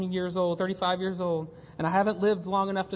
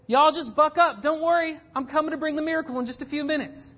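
mock breezy dismissal; 9 semitones higher, 5 dB louder than usual. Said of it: "Did he say".

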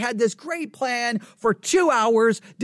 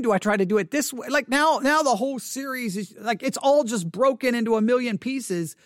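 normal talking voice

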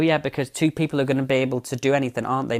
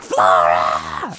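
deep called-out yell of the name; shouting a name; 11 semitones lower, 4 dB louder than usual. Retold or imitated. Mother calling for her missing daughter into the night, yelling. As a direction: call out for the missing girl.

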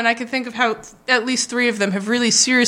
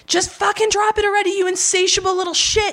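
a daughter mimicking her mother's screaming in a high, pitched-up voice; mock scream; 8 semitones higher than usual. Mother screaming at daughter in the car, mocked high. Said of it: "she starts screaming".

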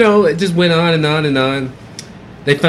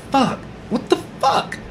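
shocked whisper; 10 semitones higher, 5 dB quieter than usual. Retold or imitated. Shock at the beating.

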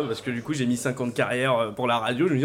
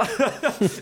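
laughing; 8 semitones higher than usual. Real, not acted. Laughing at his own joke.

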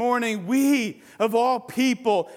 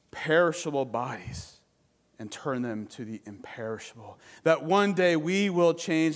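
closing prayer; 7 semitones lower, 3 dB quieter than usual. Acted. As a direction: pray it softly with long pauses. Closing prayer after sermon.

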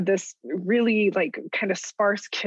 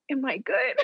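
higher, eager, anxious question; anxious hope; 6 semitones higher than usual. Retold or imitated. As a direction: beg for approval with nervous hope. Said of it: "And I was like".

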